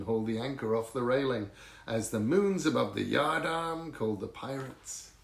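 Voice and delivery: usual speaking voice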